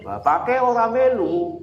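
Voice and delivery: the Indonesian rendering, drawn out. Shown as a demonstration. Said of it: "Tapi kalau dalam bahasa Indonesia".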